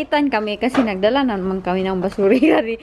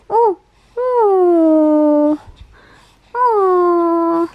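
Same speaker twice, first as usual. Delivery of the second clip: drawn-out coo; 5 semitones higher, 5 dB louder, much slower than usual. Real, not acted. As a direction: coo a long falling "oh". Cooing at the baby.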